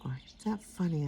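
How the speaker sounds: normal talking voice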